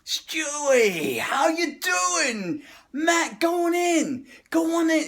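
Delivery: high, loud, slow Brooklyn shout across the street; Brooklyn guy greeting. Retold or imitated. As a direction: hail him like a pushy Brooklyn regular. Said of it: "mentality of a Brooklyn guy".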